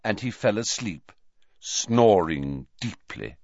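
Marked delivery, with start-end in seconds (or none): none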